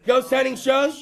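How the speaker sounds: ordinary delivery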